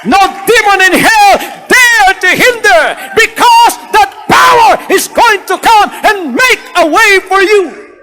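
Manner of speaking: high, loud shouted proclamation; triumphant shout to the congregation; preacher's shout. Acted. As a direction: cry it out over the crowd.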